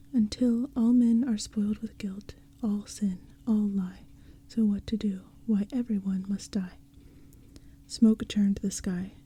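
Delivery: plain reading voice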